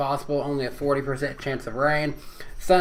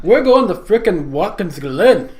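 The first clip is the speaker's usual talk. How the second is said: loud goofy brogue attempt, higher; put-on Irish accent; 4 semitones higher, 7 dB louder than usual. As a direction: belt it in a bad Irish accent. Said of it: "do it for us one more time".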